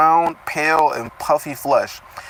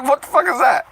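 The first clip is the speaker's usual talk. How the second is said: normal talking voice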